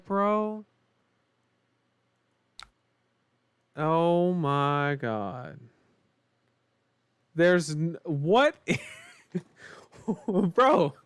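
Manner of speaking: high, laughing disbelief; amused disbelief. Real, not acted. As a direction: laugh it off in shock. Laughs at bad luck draw.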